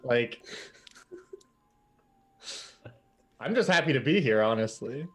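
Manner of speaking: a hint of an eager little mouse; eager to please; lightly voiced eager mouse. Jim tells the crew to yell at him, lightly eager. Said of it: "Jim says".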